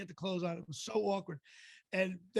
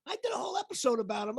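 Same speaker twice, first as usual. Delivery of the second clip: high, brisk, animated impression; Larry David; 7 semitones higher, faster than usual. Larry sends his hello to Joe, high and animated. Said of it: "just like Joe Rizza, he goes".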